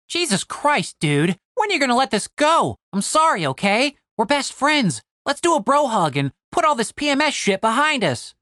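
high, pleading buddy; Tim, exasperated. Acted. Tim begs Cooper to let it go, exasperated.

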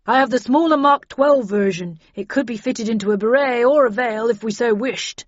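loud, hearty character voice; Bradshaw in character. Acted. Bradshaw showing his kit to the others, loud and assured. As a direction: speak out loudly as the seasoned agent.

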